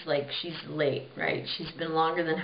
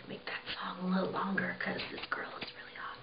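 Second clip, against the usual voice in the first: quick, softer conspiratorial whisper; 10 dB quieter, faster than usual. Retold or imitated. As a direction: hurry it out under your breath. King whispers to orchestra again.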